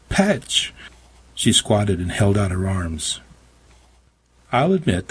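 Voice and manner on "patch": high, falling call; crying out. A narrator's reading. Meg finds her cat in the kitchen, high cry. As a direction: cry the name out high, at normal volume. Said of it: "She found me and cried".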